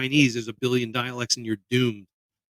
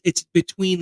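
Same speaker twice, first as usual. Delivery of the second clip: normal talking voice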